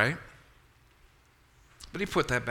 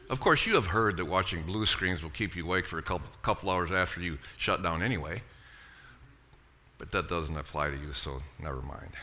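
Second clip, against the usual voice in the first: dry sarcasm; 8 semitones lower, 5 dB quieter than usual. Real, not acted. Sarcastic aside to congregation.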